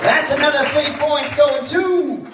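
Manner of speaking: high, loud, stretched-out score call; game show announcer. Pretend. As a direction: shout the score, drawing out the suspense. Game host announcing team score.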